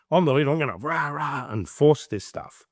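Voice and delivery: mock forceful leader.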